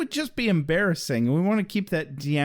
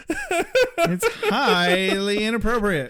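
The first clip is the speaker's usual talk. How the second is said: mock prim; 4 semitones higher, slower than usual.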